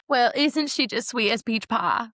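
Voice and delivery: hammed-up Southern sweetness, drawn out; Southern accent. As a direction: gush in a mock-Southern drawl.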